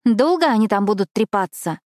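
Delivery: a touch of fretful complaint; nervous impatience. A narrator's reading. Girl eager to leave while friends chat, nervous impatience. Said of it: "нервничала Эшли".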